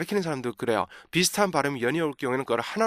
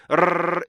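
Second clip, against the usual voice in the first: held rolled R; tongue trill; 4 semitones higher than usual. Shown as a demonstration. Teacher showing what students struggle with, rolled trill. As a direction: roll the tongue on the breath. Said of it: "이게 안 되시는 분은요".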